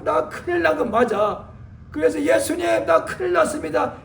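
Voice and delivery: mock panicked plea. Believer voiced crying out in panic.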